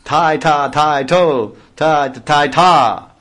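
ringing, loud chant of bols; loud rhythmic calling. Acted. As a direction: call the beats out boldly. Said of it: "she smiles and loudly says".

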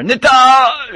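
very high, loud, drawn-out shout; imitated ecstatic cry. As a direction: let the holy name burst out high. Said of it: "he would let out this".

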